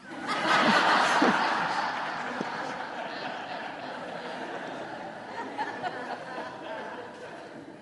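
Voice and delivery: long airy giggling laugh; high breathless laugh. Real, not acted. Priest laughing at his own sermon story, breathless laugh. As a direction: laugh at yourself.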